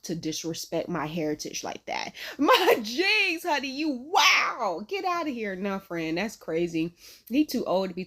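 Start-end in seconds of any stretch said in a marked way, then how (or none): 2.4-5.6 outraged laughing yelp. Retold or imitated. Mock outrage at insult.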